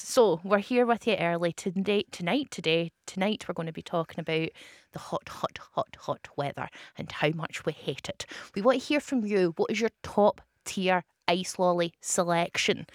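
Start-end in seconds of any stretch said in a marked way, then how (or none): 4.9-8.3 hushed, whispered aside; whispering. Acted. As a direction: whisper it like a secret. Jokey whisper about hot weather.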